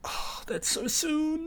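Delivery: high, soft, drawn-out gasp of excitement; excited disbelief. Real, not acted. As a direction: marvel softly in a high, stretched voice. Reacts to surprisingly near release date.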